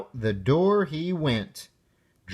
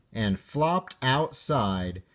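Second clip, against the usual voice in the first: ordinary delivery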